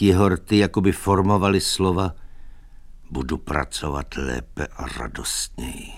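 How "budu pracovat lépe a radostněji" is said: soft, low, weakened voice; as if forming words without the strength to say them; bezhlesně, z posledních sil. A narrator's reading. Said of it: "Vyřknout je už sílu neměl". (tiše, z posledních sil)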